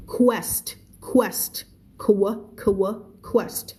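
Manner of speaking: "quest" with the kw start pulled apart; kw sound drill. Shown as a demonstration. Teacher drilling the qu sound, kw slowly split out. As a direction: break "quest" into kw and est. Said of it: "ให้ออกเสียงเป็น K W".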